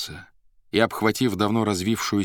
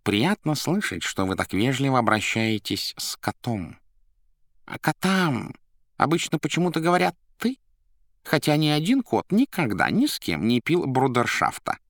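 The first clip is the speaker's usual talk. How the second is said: high-pitched, self-important cat voice; flattered cat; 7 semitones higher than usual. A narrator's reading. Cat thanking the Master for politeness, high and flattered. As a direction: preen in a raised voice. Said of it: "подтвердил польщённый кот".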